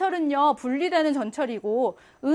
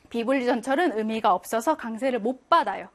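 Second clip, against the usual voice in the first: usual speaking voice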